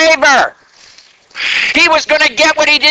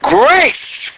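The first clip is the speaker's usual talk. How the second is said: retold shout; 7 semitones higher than usual. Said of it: "he would shout".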